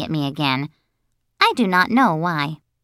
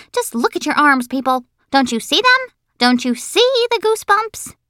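high, pleading schoolgirl; climbing, exasperated little-girl voice; insistent bossy girl; 9 semitones higher, 3 dB louder than usual. Acted. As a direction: demand that everyone look.

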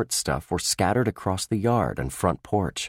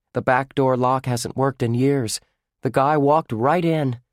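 lightly voiced young boy; brightly explaining as a boy; boy's voice; 6 semitones higher, 3 dB louder than usual. Lucky explains the broken lock.

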